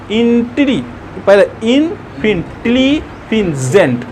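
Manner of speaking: इन, टिली, जेंट each as its own beat; teacher's piece-by-piece इन, टिली, जेंट; split in three parts. Shown as a demonstration. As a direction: sound out इन, then टिली, then जेंट separately. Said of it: "तीन बार में बोल रहे हैं".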